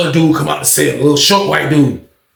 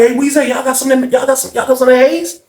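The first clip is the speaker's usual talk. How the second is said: high-pitched retold request; eager customer calling up; 8 semitones higher than usual. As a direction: imitate a buyer asking around, high. Buyer calls up asking for haze.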